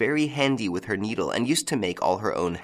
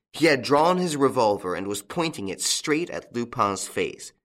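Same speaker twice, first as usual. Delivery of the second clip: ordinary delivery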